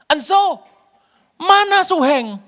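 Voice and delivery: high, loud, slow call; urgent asking. Retold or imitated. Sisera urgently asking Yael for Heber, high and loud. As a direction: ask in a hurry where he is.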